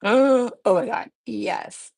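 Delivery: loud, slowed "oh my god" after a gasp; delighted gasp. Real, not acted. Artist seeing her chrome nails, loudly delighted. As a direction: gasp at how good it looks.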